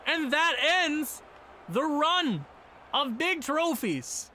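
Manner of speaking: hammed-up announcer wrap-up; mock play-by-play. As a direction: announce the loss like TV commentary. Mock sportscaster call of the result.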